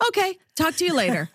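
high, fast mock sign-off from mom; hurried mom. Host imitating her mother ending the call, high and fast. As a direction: rush the goodbye like a mother done talking. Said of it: "And I'll say".

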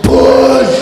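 loud, low, drawn-out burst; overexcited birth coach bellow; excited shout. Retold or imitated. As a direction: blurt it out far too forcefully. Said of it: "I became excited".

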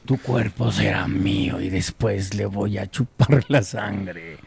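slow, low, menacing vampire; Drácula. Acted. Dracula threatens a drunk, slow and low. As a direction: draw out the threat like a creepy count. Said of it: "Así le dijo el Drácula al borracho".

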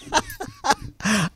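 sudden high giggle; quiet burst of high laughter. Real, not acted. Radio host cracking up over something off-mic, laughing.

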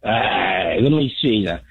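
lightly voiced New Jersey mobster; a hint of a Jersey wiseguy asking for gabagool; mild Italian-American mob accent; Joe Pesci impression. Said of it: "if you wanna try out for the impression job".

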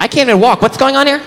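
put-on high, panicky baby. Baby doll told to cook, high and panicky. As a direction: squeal it like a helpless infant.

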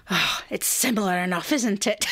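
lightly voiced breezy mum. Mum dismisses the name mix-up, lightly mimicked. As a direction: shrug it off like an unbothered mum. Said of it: "And she's like".

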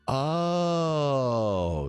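long drawn-out "oh" of realization; mock-enlightened, drawn out; dawning realization. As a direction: stretch it out as it finally clicks. Acting out his sudden realization.

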